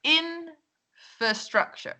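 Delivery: INfrastructure, very slow, IN high and drawn out; stress on first syllable. Shown as a demonstration. Teacher modelling the word for learners, stress on first syllable. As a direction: model "infrastructure" slowly, holding a high IN before the rest. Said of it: "bueno, esa es la primera".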